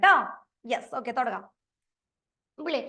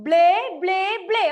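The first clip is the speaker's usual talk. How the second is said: loud chant of ബ്ലേ, ബ്ലേ, ബ്ലേ; teacher modelling saying it out loud; said loudly; 5 semitones higher, 7 dB louder than usual. Shown as a demonstration. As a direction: belt out ബ്ലേ for the class. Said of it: "ഒന്ന് ഉറക്കെ പറഞ്ഞേ".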